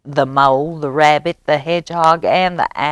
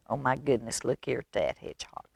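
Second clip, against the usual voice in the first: hushed aside; 3 semitones lower, 11 dB quieter, much faster than usual. Real, not acted. Aside to children showing the picture.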